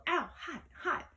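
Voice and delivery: high, pained yelp. A narrator's reading. Grover grabbing the burning whip, high pained yelps. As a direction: yelp as if burned. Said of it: "he yelled".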